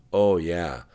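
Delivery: lightly sarcastic fake enthusiasm; understated sarcasm. A narrator's reading. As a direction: say it with dry, mock approval. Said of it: "said Katie sarcastically".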